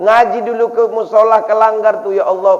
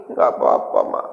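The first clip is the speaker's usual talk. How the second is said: put-on whispered, hushed little-kid reply; mock sniffly child's whisper; tearful child. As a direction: whisper like a kid holding back tears. Child hiding tears.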